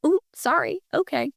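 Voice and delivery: high sheepish ooh, sorry, caught out; sheepish apology. Retold or imitated. [name] apologizing to colleagues, high and sheepish. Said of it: "I'm like".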